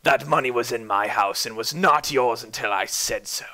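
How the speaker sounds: cold whispered threat; whispered menace. Acted. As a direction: whisper it with a chuckle behind it. Roderick chuckling, menacing.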